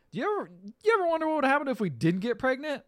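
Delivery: mock Jake. Retelling Jake's odd question.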